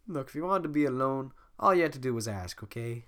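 usual speaking voice

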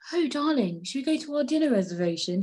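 high, quick, affected sweetheart voice; put-on boyfriend voice. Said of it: "then he's like".

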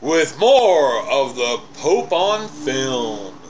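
slow, stretched-out announcer call; drawn-out announcer. Acted. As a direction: drag out the welcome back. Drawn-out show return.

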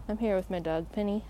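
ordinary talk

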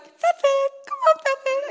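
high, lightly loud imitation of a girl; child calling dog. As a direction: coax the dog over as a child would.

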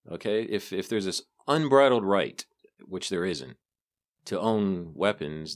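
normal talking voice

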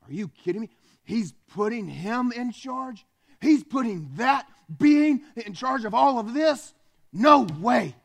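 Satan exploding in jealousy; outraged devil. Acted. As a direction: build into a furious no way. Satan erupts at man's dominion.

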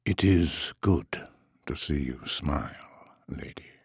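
soft tender whisper. Acted. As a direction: breathe it gently to her. Tender remark to Callista.

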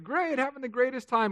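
high, put-on cheerful answer; fake enthusiasm. Pastor mimics everyone lying about their day, falsely chirpy. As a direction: pretend everything is wonderful.

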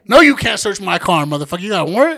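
loud defiant refusal to a cop; mock defiant. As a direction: stand your ground, loud and cocky. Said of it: "Should've been like".

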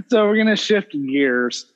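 normal talking voice

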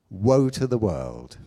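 normal talking voice

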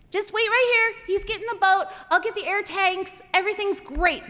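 high, eager chatter; excited reassurance. Retold or imitated. Dad getting ready for the boat, excited reassurance. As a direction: rattle off the plan, all keen.

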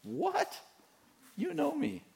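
squeaky high, innocent protest; high mock innocence. Pastor voicing his own innocence to his wife, pitched far up. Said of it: "she'll say".

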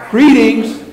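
high, projected greeting; called out to everybody. Shown as a demonstration. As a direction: hail the whole congregation. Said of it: "look out at everybody and go".

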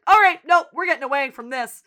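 loud, high, cutting in firmly; urgent intervention. Retold or imitated. Boyfriend steps in to get him away, urgent intervention. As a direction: act out breaking up a fight. Retold stepping in to end fight.

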